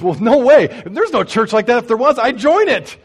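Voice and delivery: loud, high amazement; amazed diner guy. Retold or imitated. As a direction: blown away, voice up and loud.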